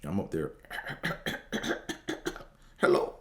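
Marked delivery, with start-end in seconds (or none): 0.6-2.4 weak, wheezy put-on cough; faked sick cough. Faking a sick cough for the caller's sympathy. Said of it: "I would try to act sick". 2.8-3.1 fake sick voice. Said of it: "act sick for sympathy".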